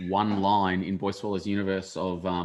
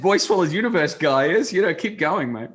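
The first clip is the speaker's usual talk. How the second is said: higher, louder mock gloat of a rich songwriter; 9 semitones higher, 7 dB louder than usual. Winter Wonderland guy gloating over royalty money, hammed-up. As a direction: crow about the royalties rolling in.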